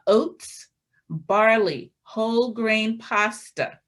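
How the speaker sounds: ordinary talk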